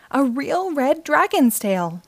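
ordinary delivery, nothing marked

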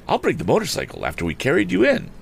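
high, quick offer; eager youth. A narrator's reading. Boy offers to fetch the motorcycle, quick and eager. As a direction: pipe up briskly as a helpful boy.

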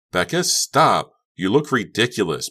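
loud, high angry outburst; screaming. A narrator's reading. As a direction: scream it at a friend. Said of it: "Becca screamed".

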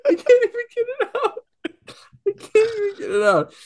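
squeaky, high laughter breaking up the words; laughing too hard to get the words out; high-pitched wheezing giggle fit; helpless laughing. Real, not acted. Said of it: "I'm literally crying".

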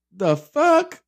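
high, loud outraged what; outraged disbelief. Real, not acted. Vlogger reacts to her man's move-in plan, outraged disbelief. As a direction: burst out in disbelief.

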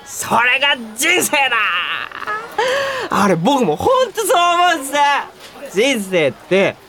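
slurred, swooping high on key words; drunken enthusiasm. Acted. Drunk man quoting his colleague's motto back, gushing and slurred. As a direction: declaim it tipsily, stretching the words. 飲みすぎじゃない？.

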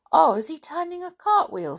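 high, bright admiring voice; full of admiration. Acted. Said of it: "said Anne admiringly".

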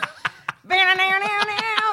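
high-pitched burst of laughter. Real, not acted. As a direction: cackle high in the throat. Laughing at the impression.

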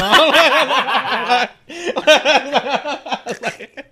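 high laughing burst; a big helpless laugh at the memory; loud laughter. Real, not acted. Friend cracking up retelling the forgotten best man, loud laughter.

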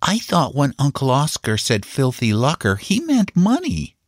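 put-on high, earnest child's voice; puzzled young boy. A narrator's reading.

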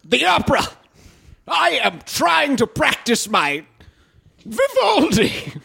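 high-pitched, affected indignation; slow, grandly overdramatic high voice; Frasier. Acted. Fussy son practicing his Vivaldi, high theatrical indignation. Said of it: "I'm Frasier".

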